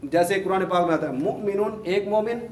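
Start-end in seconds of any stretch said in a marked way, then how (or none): none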